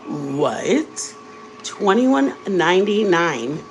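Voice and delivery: slow, rising "Twenty-one ninety-nine?" in disbelief; shocked disbelief. Retold or imitated. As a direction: drag out the price like you can't believe it. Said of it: "And I'm like".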